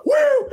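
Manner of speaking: very high imitated whoop; mock wrestler's "woo"; Sting. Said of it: "He started doing the".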